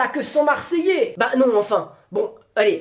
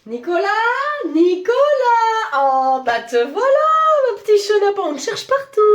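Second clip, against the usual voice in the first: high, loud, singsong calling of a name; mock sweet boss; 9 semitones higher, 5 dB louder than usual. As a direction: sing out Nicolas like a nosy boss.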